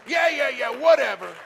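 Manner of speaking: loud, high brush-off yeahs; dismissive shout. Retold or imitated. Healed customer brushing off the praise, loud dismissive whatever. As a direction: wave it away loudly, whatever. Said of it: "He says, uh".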